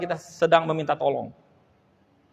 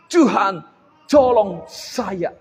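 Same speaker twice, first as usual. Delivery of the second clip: mock cool plea; much slower than usual. Shown as a demonstration. Preacher mocking a fancy way of crying to God, drawn out. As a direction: ham it up like a cool drama hero. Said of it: "bisa ngomongnya gini".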